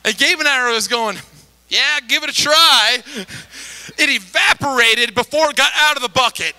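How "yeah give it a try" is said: louder, higher go-ahead; men telling wife to throw water, cheerful call; encouraging call. Retold or imitated. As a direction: humor her with cheer. Men egging her on.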